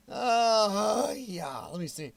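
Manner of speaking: ordinary delivery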